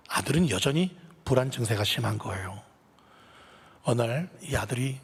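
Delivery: normal talking voice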